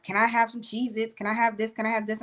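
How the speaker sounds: ordinary delivery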